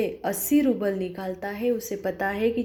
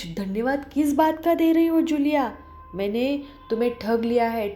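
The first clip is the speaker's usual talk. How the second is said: angry shout; 4 semitones higher than usual. Acted. The employer shouts at Julia, losing his temper. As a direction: yell it out, losing control. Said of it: "एकदम चिल्ला रहा है".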